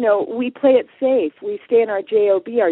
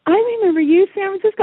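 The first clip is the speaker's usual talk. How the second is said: high, louder burst of recognition; retold surprised outburst, pitched way up; startled recognition; 11 semitones higher, 4 dB louder than usual. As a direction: act out suddenly spotting a familiar face. Seminar leader recognises her in audience.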